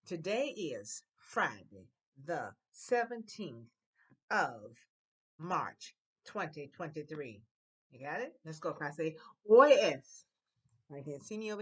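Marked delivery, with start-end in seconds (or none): 0.1-7.4 very slow, word by word; slow dictation. Shown as a demonstration. Teacher dictates the answer slowly to the class. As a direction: say it slowly so students can follow. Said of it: "Now you're gonna say".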